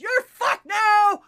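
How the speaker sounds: full-force high, drawn-out shout; punchline yell. Acted. Joke teller as man throwing her into ocean, huge high yell. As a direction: bellow it slowly as the big finish.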